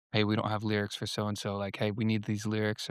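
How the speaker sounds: soft, unobtrusive cue to the band; reverent talkback. Acted. Worship talkback request during a sweet moment, reverent and soft. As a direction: keep it hushed and respectful of the moment. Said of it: "you're being reverent for the".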